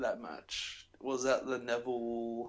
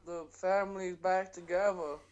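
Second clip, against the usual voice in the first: higher, drawn-out Southern announcer; JBL impression; 7 semitones higher, 5 dB louder than usual. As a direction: put on a high, slow Southern commentator. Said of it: "Gabriel was like".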